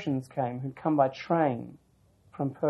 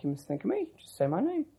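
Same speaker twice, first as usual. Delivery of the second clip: soft, higher-pitched gentle instruction, lightly imitated; lightly voiced Baba; 8 semitones higher, 8 dB quieter than usual. Baba tells her what to do about snakes, lightly imitated gentle voice. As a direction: quote a teacher's quiet advice, lifting the pitch. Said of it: "Baba just sort of nodded his head very gently".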